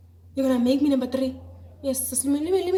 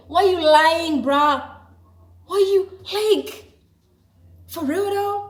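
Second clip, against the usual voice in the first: louder and higher, indignant disbelief; exasperated call-out; 4 semitones higher, 6 dB louder, slower than usual. Retold or imitated. To the lying friend, loud exasperated disbelief. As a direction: call him out on the lie. Scolding the friend who lied.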